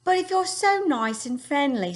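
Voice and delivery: young Sophie in a put-on English accent. Teacher reading to her class as Sophie, English accent. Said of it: "we practice what I think is an English accent".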